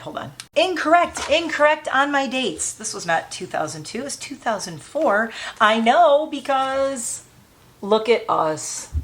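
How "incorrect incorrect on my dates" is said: incorrect pitched high, a startled catch; surprised self correction. Real, not acted. Catching a wrong date on an old photo, surprised. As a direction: spot the wrong date and call it out.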